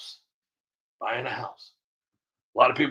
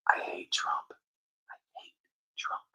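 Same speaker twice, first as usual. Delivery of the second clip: secret confession; 8 dB quieter than usual. Retold or imitated. Democrat voiced confessing hating Trump.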